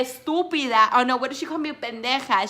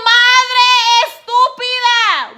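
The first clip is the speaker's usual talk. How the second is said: high, loud, slow drawn-out retort; yelled comeback; 12 semitones higher, 13 dB louder, slower than usual. Retold or imitated. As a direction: yell it back at her in sing-song. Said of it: "And I was like".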